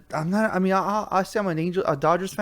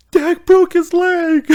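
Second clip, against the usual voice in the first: put-on high, slow, louder child voice, laughing; little kid voice; 9 dB louder, much slower than usual. Little Jojo announcing Dak broke his leg, child voice. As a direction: play the little one breaking the news. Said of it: "little one".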